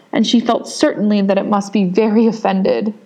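ordinary delivery, nothing marked

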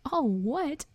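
high-pitched incredulous echo; outraged question, pitch swooping high; thundered disbelief. A narrator's reading. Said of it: "Hagrid thundered".

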